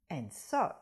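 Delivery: plain reading voice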